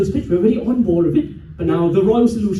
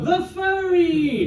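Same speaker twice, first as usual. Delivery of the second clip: high, slow proclamation; mock-grand herald's call; ceremonial hail; 7 semitones higher, much slower than usual. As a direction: stretch the title out for the crowd. Leads crowd in a chant.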